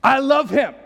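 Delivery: loud, high, drawn-out shout of love; proud Father declaring. Acted. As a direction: bellow your pride in your son, stretching the words. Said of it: "The Father says".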